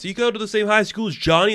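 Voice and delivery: lightly voiced classmate in a rushed questioning tone; nosy classmate. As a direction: rattle it off like an eager kid. Imagined classmate asks him.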